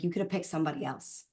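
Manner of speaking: soft, quick murmur to herself; half-whispered, rushing the words; hushed quick aside to God. Retold or imitated. Telling herself on the call, a soft quick murmur. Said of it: "I'm like".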